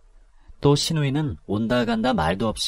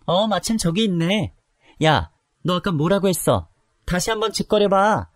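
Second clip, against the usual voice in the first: put-on drunken rage; 7 semitones higher than usual. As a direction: curse her out, slurring. Drunk confronting her outside. (술 취해 욕하는 목소리)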